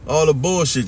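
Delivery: usual speaking voice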